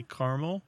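caramel shortened to CAR-mul; two syllables in caramel, CAR-mul. Shown as a demonstration. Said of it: "I think I say caramel".

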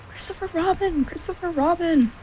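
high, urgent storybook call; crying out. A narrator's reading. Piglet appeals to Christopher Robin for help, high cry. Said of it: "cried Piglet".

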